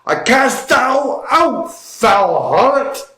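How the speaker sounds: high, drawn-out theatrical proclamation; mock yell. Mocking what the fiancé should have yelled at the flirting friend, hammed-up slow yell. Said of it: "Start yelling".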